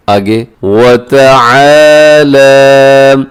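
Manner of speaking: वतआला with आ and ला held long. Shown as a demonstration. Teacher stretching the standing zabar for students. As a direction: draw out वतआला. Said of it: "लाम के ऊपर खड़ा ज़बर है".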